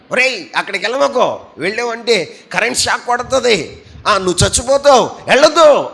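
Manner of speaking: put-on loud, high shouted warning to a child; a parent shouting danger at a child. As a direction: yell to stop the child in time. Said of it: "అని కేకలు వేస్తావు".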